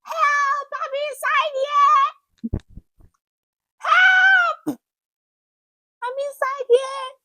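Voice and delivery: trapped in the dark, screaming for passers-by to hear; screaming for help. Retold or imitated. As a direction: scream it up from the bottom of a pit. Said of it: "I started screaming from inside".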